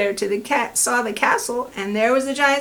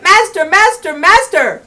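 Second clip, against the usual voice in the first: high, loud alarmed cry in a strange voice; harp crying out; 9 semitones higher, 10 dB louder than usual. Acted. Golden harp calling the giant for help, loud cry.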